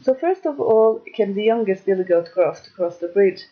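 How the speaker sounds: plain reading voice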